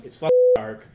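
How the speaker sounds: sudden high yell of panic; panicked. Retold or imitated. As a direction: voice leaps in fright. Panicked inner monologue.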